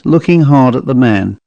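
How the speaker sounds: usual speaking voice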